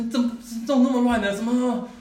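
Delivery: 高声快速的怒斥; 像生气的父亲大声骂孩子; angry scolding. Retold or imitated. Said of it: "我就骂，我说". (父亲冲着乱桌子的孩子高声快骂)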